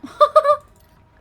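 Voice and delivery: sudden shrieking laugh, way up high; startled laugh. Real, not acted. Gamer hit hard by the monster, shrieking laugh. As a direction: burst out in a loud yelping laugh.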